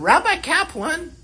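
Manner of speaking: put-on high, slow little girl calling out teacher. Fourth-grade girl calls her rabbi, high little-girl voice. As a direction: play a shy fourth-grader raising her hand. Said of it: "that's how she talked".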